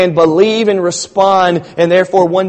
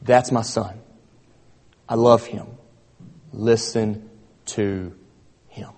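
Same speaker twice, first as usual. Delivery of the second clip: hushed voice of God; 6 semitones lower, 9 dB quieter than usual. Acted. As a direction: let it fall hushed and heavy, with long pauses. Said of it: "God speaks up in the cloud and says".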